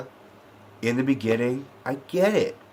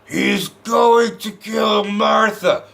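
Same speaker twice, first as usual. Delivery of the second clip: Superman; 7 semitones higher, much slower than usual. Retold or imitated. Reviewer mimics Superman pinned under Batman's boot, hammed-up strained shout. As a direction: cry it out slowly, hammed-up and urgent. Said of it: "Superman goes".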